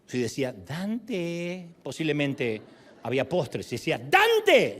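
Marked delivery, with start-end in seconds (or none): 0.5-1.7 soft, drawn-out Dante; mother's sweet call. Retold or imitated. Mother calling her boy to dessert, sweet and soft. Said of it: "me llamaba ... por su tono". 4.0-4.8 high, loud yell of Dante; mother's impatient call. Retold or imitated. Mother calling her late boy to dinner, impatient yell. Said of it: "ya llevaba una hora de atraso".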